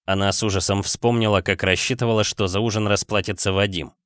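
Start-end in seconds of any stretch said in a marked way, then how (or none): none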